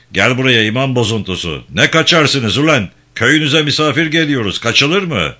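loud, high-pitched scolding; angry bawling. Acted. Gendarme chief summons the runaway imam, loud and angry. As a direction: bawl them out with authority.

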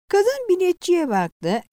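usual speaking voice

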